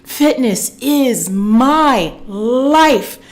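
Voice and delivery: very slow, stretched-out mantra; passionate affirmation. Shown as a demonstration. Coach has viewers repeat with passion, passionate affirmation. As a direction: lean long and hard on every word. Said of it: "say it with that passion".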